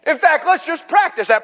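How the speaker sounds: normal talking voice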